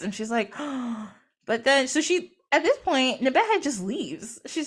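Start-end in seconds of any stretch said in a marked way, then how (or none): 0.6-1.1 sharp theatrical intake of breath; mock shocked gasp. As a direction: gasp as if scandalized. Acting the shocked listener.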